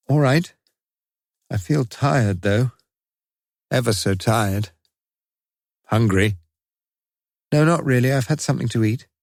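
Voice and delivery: put-on a weary boy answering his doctor; young patient's voice. A narrator's reading. Bandaged boy answers the surgeon, lightly voiced and tired. Michael in hospital bed.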